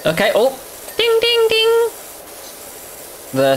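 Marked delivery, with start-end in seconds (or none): 1.0-1.9 high sung ding ding ding; imitated ding. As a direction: chime like a game bell. Imitating a success chime.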